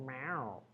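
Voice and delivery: quiet imitated cat meow; soft meow. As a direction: meow softly at the kitty. Meowing at the cat.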